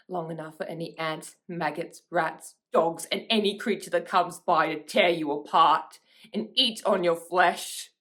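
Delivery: measured threat swelling into loud fury; building angry threat. Acted. Sister threatens her sibling's killer, building to loud anger.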